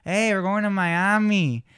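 slow, drawn-out Miami accent. Shown as a demonstration. Host demonstrates the Miami accent. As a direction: stretch it out, Miami Cuban style. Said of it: "Miami definitely has an accent".

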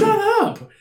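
high mock yell; retold angry yell. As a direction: snap it out high at mom. Retold yelling at mom.

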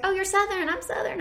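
high, mocking imitation of people; mock Southern people. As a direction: parrot them, way up high. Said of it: "people are like".

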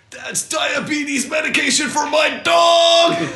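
high, slow shouted warning; retold panicked shout. Friend yelling at high buddy about the dog pills. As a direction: yell it up high and drawn out. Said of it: "He was like".

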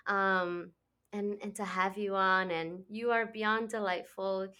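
normal talking voice

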